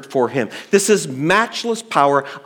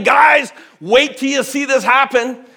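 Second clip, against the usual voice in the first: higher hyped-up showman call; mock showman; 9 semitones higher than usual. The Jesus he is not, hyping the disciples up, mock showman. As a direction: oversell it like a magic act. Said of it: "He's not saying".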